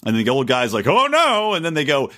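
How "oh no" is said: high, loud mock "oh no" of a worried old dad; mock dismay. Podcaster mocking the old dad in a finance ad, hammed-up loud high dismay. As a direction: ham up the old man's dread.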